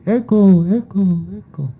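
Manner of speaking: high call fading away like an echo. Retold or imitated. Explaining the echo to the audience, high fading calls. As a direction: call out then let it die off, echoing. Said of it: "tú dirías".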